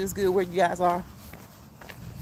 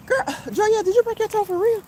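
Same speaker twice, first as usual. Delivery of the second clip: surprised; 10 semitones higher than usual. Real, not acted. Asking about a broken toe.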